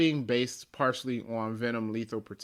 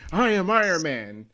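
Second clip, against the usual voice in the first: loud, higher-pitched superhero declaration; mock heroic announcement; 6 semitones higher, 8 dB louder than usual. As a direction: proclaim it like an over-the-top hero confronting a villain. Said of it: "jumped in front of Thanos and went".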